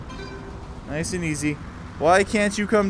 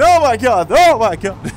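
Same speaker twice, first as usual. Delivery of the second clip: loud, alarmed outburst; startled yell; 5 semitones higher, 9 dB louder than usual. Real, not acted. Player nearly hit by an airplane, startled yell. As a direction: cry out in sudden shock, louder. Near miss with airplane in game.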